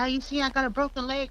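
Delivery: high, quick excited retelling; imitated excitement. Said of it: "you know, excited".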